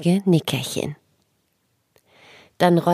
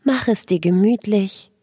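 sleep story narrator. Acted. Guided relaxation for children.

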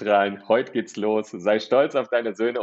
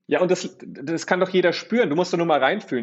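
enthusiastic; 7 semitones higher than usual. Real, not acted. Enthusing about life.

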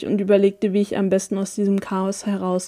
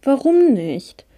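put-on whiny, slow child's protest, higher pitched; quengelnd; 4 semitones higher, 4 dB louder, slower than usual. A narrator's reading. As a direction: whine it, stretching the words upward and down. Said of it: "quengelte Amalia". (quengeliges, gedehntes Kinderjammern)

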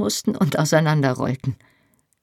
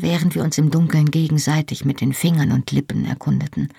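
usual speaking voice

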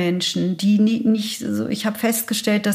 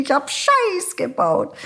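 cry out; 6 semitones higher, slower than usual. Retold or imitated. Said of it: "hab geschrien".

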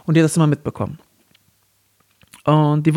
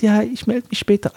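high put-on female voice; lady's voice; 7 semitones higher than usual. Man ending the fake call with the lover, lady's voice. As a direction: keep up the woman act, pitched up. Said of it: "mit dieser Damenstimme so an".

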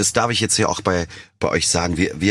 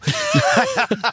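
very high burst of laughter. Real, not acted. Laughing after joking about drinking again, very high. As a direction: squeal with laughter at yourself.

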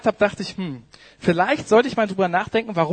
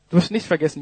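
usual speaking voice

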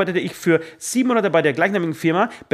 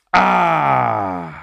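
frustrated cry; 3 semitones lower, 4 dB louder than usual. Real, not acted. Realizes mistake while writing.

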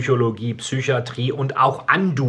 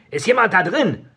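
calling out; 7 semitones higher than usual. Acted. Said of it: "rief eine Stimme".

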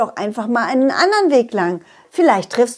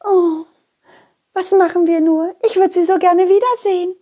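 high, dreamy lady donkey, a bit dizzy; dazed lady donkey; 8 semitones higher than usual. Acted. Lady donkey after bumping heads with the donkey, dazed and high. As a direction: she just bumped heads and shakes it off.

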